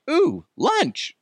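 high, squeaky greedy dog voice. Retold or imitated. Dad voicing the dog as it spots the bird, high greedy dog voice. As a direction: voice the dog spotting lunch.

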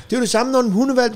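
normal talking voice

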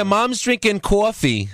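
New York accent with "coffee" as cwaw-fee. Shown as a demonstration. Demonstrating regional New York vowel.

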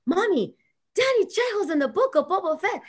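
high-pitched imitation of an excited kid; excited daughter. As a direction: squeal the news to mom, imitating your daughter. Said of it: "my daughter was so excited to see you".

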